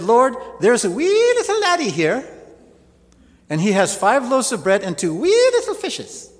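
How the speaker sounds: high, loud jokey Scottish brogue; mock Scottish accent. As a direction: ham up a Scottish accent for the laugh. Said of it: "he invented the Scottish accent".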